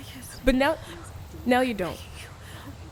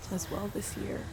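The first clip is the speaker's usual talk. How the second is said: fading whisper; 6 semitones lower, 12 dB quieter than usual. Acted. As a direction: trail off into a whisper. Grieving at the grave.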